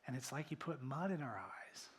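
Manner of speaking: ordinary delivery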